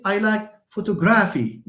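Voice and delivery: photography with a heavy beat on GRA; Italian-sounding mispronunciation. Shown as a demonstration. Teacher mimics someone saying it wrong, Italian-like stress. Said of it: "You are Italian".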